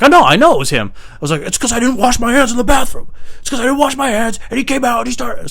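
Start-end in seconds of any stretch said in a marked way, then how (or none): 0.0-0.9 loud, raised-pitch retort; heated insistence. Retold or imitated. Retold angry insistence to bosses. 1.4-5.5 very high, indignant rant; worked up, voice pitched right up; pissed off. Retold or imitated. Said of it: "I'm actually pissed".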